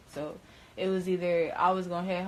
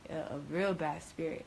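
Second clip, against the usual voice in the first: low, very soft, hesitant; quiet, understated dread; hushed retold confession; 3 semitones lower, 8 dB quieter than usual. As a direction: confide it under the voice. Retold fearful call to mom.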